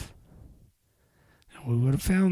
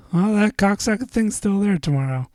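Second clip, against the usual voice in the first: higher, louder frustrated rant; 7 semitones higher, 5 dB louder than usual. Real, not acted. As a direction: spit it out annoyed. Venting about work.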